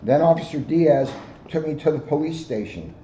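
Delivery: ordinary delivery, nothing marked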